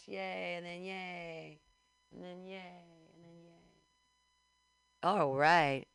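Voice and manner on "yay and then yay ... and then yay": each yay softer and slower until gone; goofy cheer trailing off into silence; fading yays. Pretend. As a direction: let the yays die away.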